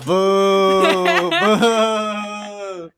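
high, drawn-out playful booing; long teasing jeer; mock heckling. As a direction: boo like a jeering audience, laughing. Mock heckling the accent attempt.

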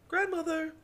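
shrill-free high yell of Grandmother!; high shouted call. Retold or imitated. Mocking the formal grandmother call.

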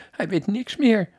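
high, plaintive wail; wailing mother.

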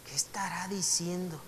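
whispered, baffled aside to oneself; puzzled whisper. Acted. A literal reader, confused by a euphemism, whispering to himself. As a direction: whisper it as if you can't make sense of it.

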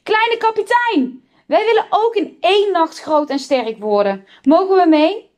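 put-on high-pitched eager children's call; excited kids shouting a request. A narrator's reading. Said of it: "en riepen".

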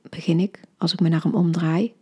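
plain reading voice

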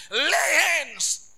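loud, drawn-out preaching cry; preacher's shouted refrain. Real, not acted. Preacher urging laying on of hands, shouted slowly. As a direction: stretch it into a big shout.

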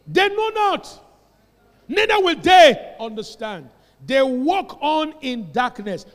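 loud, slowed, proclaiming verse; forceful scripture reading. Acted. Preacher reading Psalm eighty-two to the church, loud and slow. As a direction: read the verse like a pronouncement.

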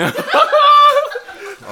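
high squealing laugh through the words; cracking up mid-story; laughing hard. Real, not acted. Friend retelling his basketball fight, laughing hard.

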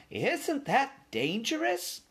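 put-on very high, slow old-lady voice; anxious elderly mouse, drawn out; worried old aunt. A narrator's reading. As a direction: fret it out up high.

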